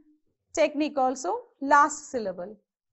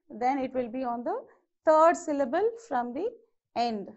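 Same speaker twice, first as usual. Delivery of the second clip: ordinary delivery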